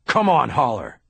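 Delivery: loud, sharp bark of a name. A narrator's reading. Bosch pressing Haller for answers, loud bark. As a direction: snap at him to cough it up. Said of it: "Bosch barked at me".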